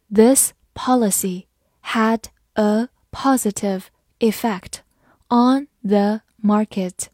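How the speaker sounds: slow, deliberate, word by word; slow reading. Shown as a demonstration. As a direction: read it slowly so they can follow along. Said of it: "跟着我慢读一遍". (跟着我慢读)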